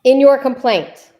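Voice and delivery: exasperated lawyer hammering the point home; insistent emphasis. Real, not acted. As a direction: lean hard on YOUR in "In your complaint". Lawyer insists, repeating pointed answer.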